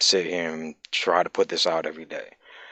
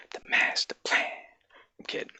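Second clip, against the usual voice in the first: sinister whispered aside; whispering; 6 dB quieter than usual. Pretend. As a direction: breathe it like a secret villain.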